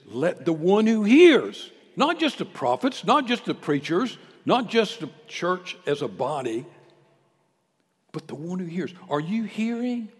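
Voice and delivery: loud, rising sermon cry; preaching at full force. Real, not acted. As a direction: call out to the congregation with full force.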